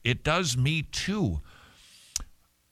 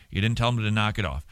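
usual speaking voice

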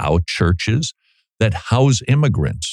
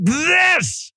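high full-voiced shout on "this"; loud demo. Shown as a demonstration. Showing the heat critics wanted, shouting "this". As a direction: blast the word "this" to show heat. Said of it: "I didn't say it like".

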